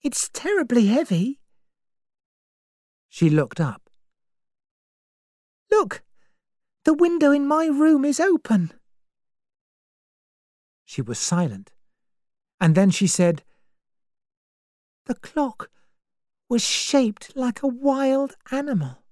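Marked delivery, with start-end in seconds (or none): 0.0-1.4 put-on higher, anxious young woman; young woman's voice. Vera's lines in the story. 5.7-8.7 put-on higher, anxious young woman; young woman's voice. Vera points out the window. 15.0-19.0 put-on higher, anxious young woman; young woman's voice. Vera's lines in the story.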